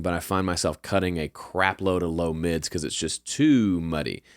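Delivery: usual speaking voice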